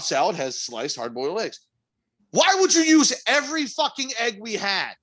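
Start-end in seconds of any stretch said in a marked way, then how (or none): 2.3-4.9 exasperated. Real, not acted. Continuing exasperated rant.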